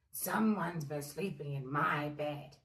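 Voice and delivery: low, loud bear growl; growling Daddy Bear. A narrator's reading. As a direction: growl like a cross papa bear. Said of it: "he grumbled".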